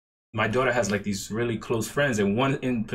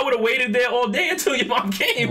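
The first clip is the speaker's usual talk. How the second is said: laughing; 11 semitones higher, 6 dB louder than usual. Real, not acted. Laughing at the absurd story.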